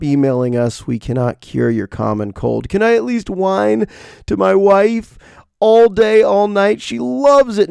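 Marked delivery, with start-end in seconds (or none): 2.7-7.7 high pleading whine; whiny. Pretend. Husband begging to whine to his wife, high and pleading. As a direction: whine like a sick child.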